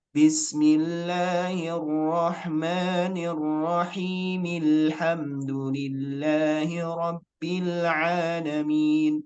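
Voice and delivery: slow chant running "Bismillahirrahmanirrahim" into "Alhamdulillahi" as "rahimil-hamdu"; linked recitation. Shown as a demonstration. Teacher joins bismillah to Al-Fatihah, slow linked recitation without the al. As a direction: recite joined, dropping the first a of "Alhamdulillahi". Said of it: "Kita sambung dengan sebelumnya bismillah".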